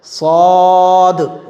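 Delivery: "सौद" held very long with full rounded vowel; pronunciation demo. Shown as a demonstration. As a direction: stretch out "सौद" slowly on a level tone. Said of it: "इस तरह से". ("सौद" को खींचकर मोटा पढ़ें)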